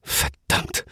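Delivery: voiceless hissed Verdammt under the breath; softly whispered Verdammt, no voice; whispered curse. A narrator's reading. Panic as followed.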